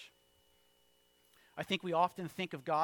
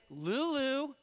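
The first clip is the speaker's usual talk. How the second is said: put-on raised, higher call to a child; firmer call; 9 semitones higher, 5 dB louder than usual. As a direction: call after a daughter who is ignoring you. Second call to daughter walking away.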